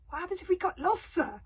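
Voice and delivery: high, anxious question; pleading. Acted. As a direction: plead with the leader, pitch up. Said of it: "pleaded Fishlegs".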